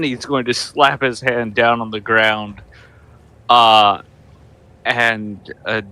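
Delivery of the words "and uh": ordinary talk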